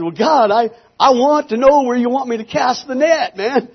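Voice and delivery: high, loud plea. Retold or imitated. Minister retells demanding direction from God, loud and desperate. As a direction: push it out urgently, pitched well up. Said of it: "I start raising my voice".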